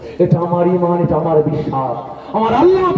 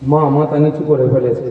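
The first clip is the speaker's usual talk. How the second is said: ordinary delivery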